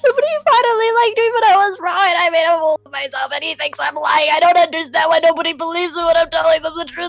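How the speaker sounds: high, fast, tumbling sob-talk; hammed-up wail up to the heavens. [name] wails to Cordelia about her date, high and fast. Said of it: "Ugly crying starts. Wails".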